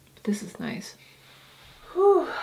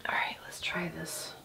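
soft unvoiced whisper; 13 dB quieter than usual. Real, not acted. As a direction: whisper it so nobody wakes. Whispering at night by camp.

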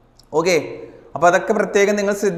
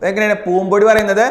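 normal talking voice

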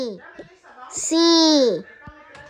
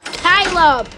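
higher, sharp warning call of a name; warning mom; 6 semitones higher, 4 dB louder than usual. Acted. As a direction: call the kid's name like a mother at her limit. Mom warns child Caleb.

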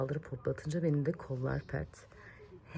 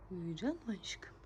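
soft whispered question, falling; 10 dB quieter than usual. Real, not acted. Mother whispering again as the baby falls asleep. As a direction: settle her with a hush.

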